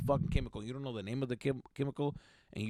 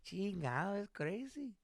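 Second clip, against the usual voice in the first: high, softer sympathetic paisa voice; paisa act; 6 semitones higher, 6 dB quieter than usual. Acted. Pulling the paisa on a talker, feigned sympathy. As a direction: play a paisa humoring someone, pitched up. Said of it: "should have pulled the paisa on him".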